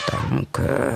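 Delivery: muffled grumbly thanks; mumbled. A narrator's reading. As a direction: mumble it like a humbled elephant. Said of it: "brummelte Elefant". (leise brummelnd)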